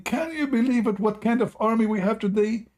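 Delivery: hammed-up drill sergeant screaming. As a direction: rant at the recruit in mock despair. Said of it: "And he starts screaming".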